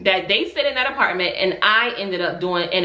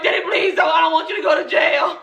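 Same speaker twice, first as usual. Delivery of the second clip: pitched way up, half shouting; high shouted plea; 8 semitones higher than usual. Retold or imitated. Daughter shouting at father not to go to jail. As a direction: yell a plea at daddy. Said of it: "And I'm like".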